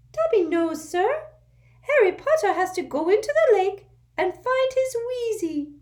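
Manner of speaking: urgent little servant squeaking; an anxious house elf waking his master; squeaky house elf. A narrator's reading. Dobby voiced.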